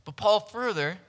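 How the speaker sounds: ordinary delivery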